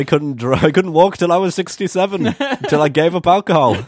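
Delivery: deadpan exaggerated tall tale; mock claim. Teasing friends about northern drinking, hammed-up.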